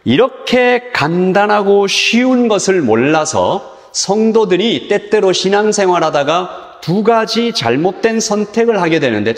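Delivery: ordinary delivery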